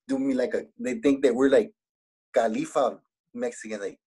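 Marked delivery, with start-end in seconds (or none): none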